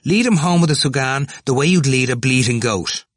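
high, forceful, contemptuous order; brutal. A narrator's reading. Cranley dismissing Temple before Dixon, brutal. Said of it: "Cranley said brutally".

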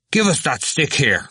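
high, sharp order; angry demand. A narrator's reading. Cranley demanding Stephen's stick to chase Temple, angry.

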